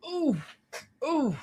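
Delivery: high little pained oohs while sitting down. Real, not acted. Sitting down on aching joints, high pained oohs. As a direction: wince aloud as the joints complain. Settling in with arthritis pain.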